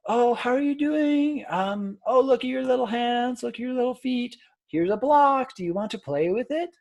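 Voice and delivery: high, slow, exaggerated baby talk. Shown as a demonstration. Lecturer demonstrating baby talk to an imagined baby, high and exaggerated. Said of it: "a little slower, a little higher pitch, a little bit exaggerated".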